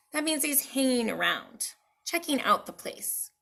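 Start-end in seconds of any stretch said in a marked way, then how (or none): none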